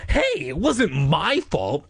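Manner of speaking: high, drawn-out indignant protest; slow, emphatic acted annoyance; angry insistence. A narrator's reading. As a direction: protest hotly, in character. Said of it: "I insisted angrily".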